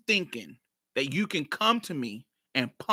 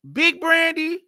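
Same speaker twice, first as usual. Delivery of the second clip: surprised realization; 12 semitones higher, 5 dB louder, slower than usual. Retold or imitated.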